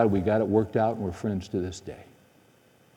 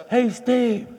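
calling out from down a hallway; 14 semitones higher than usual. Retold or imitated. Man calls out again approaching.

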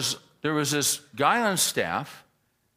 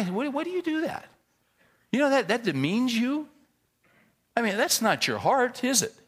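high-pitched, earnest appeal; loving confrontation; 10 semitones higher than usual. Retold or imitated. Pastor confronting a joking elder in his loving way, high-pitched appeal. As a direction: plead with him, pitch well up. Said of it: "in my loving way".

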